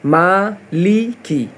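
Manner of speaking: slow, careful মালিকি with a clear i in লি and কি; teacher spells out মালিকি syllable by syllable; correct maliki. Shown as a demonstration. Teacher showing students the correct slow মালিকি. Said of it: "লি কি".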